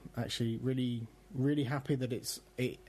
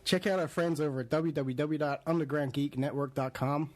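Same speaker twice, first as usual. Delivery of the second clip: bad American accent. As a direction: read the web address like a badly faked American radio plug. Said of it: "I'm not gonna do the accent again".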